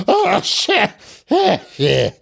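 very high sneeze, trailing smaller ones; acted sneezing. A narrator's reading. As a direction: sneeze it out in a fit. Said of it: "prüszkölök a folyosón".

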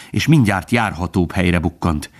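usual speaking voice

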